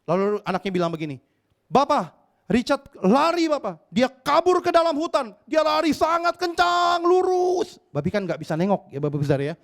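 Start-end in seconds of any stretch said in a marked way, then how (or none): 1.7-7.8 put-on high, urgent child's report, drawn out; a frightened boy blurting the news; breathless son telling his father the pig ran off; panicked son.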